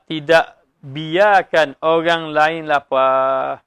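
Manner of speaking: "tidak biarkan orang lain lapar" spoken very slowly, every word weighed; preacher drives home the meaning to listeners, slow and deliberate; slow deliberate emphasis. Shown as a demonstration. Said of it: "stressing the meaning slowly".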